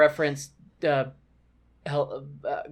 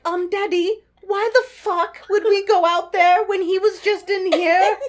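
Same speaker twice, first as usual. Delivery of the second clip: put-on high-pitched child pestering Daddy, louder as it goes; skit kid voice; 13 semitones higher, 6 dB louder than usual. Kid challenging father's silly story in an imagined skit, high child voice. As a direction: play an incredulous kid, very high and loud. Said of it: "a skit where the kids are just like".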